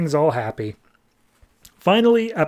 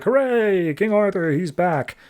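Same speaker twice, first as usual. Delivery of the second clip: high, mock-cheering hooray; cheering crowd; 6 semitones higher than usual. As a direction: hail the returning king.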